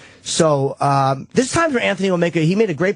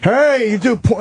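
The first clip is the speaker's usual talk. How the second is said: higher, louder jeering at a porn star; mock hacky heckler; 6 semitones higher, 3 dB louder, slower than usual. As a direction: taunt her like a cheap shock jock. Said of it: "so fucking hacky to go after them".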